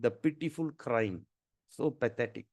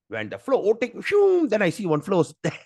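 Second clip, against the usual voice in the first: higher and much louder, laughing at the end; excited punchline; 7 semitones higher, 7 dB louder than usual. Real, not acted. Revealing the rider to listeners, loud and higher with a laugh. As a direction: burst out with the reveal.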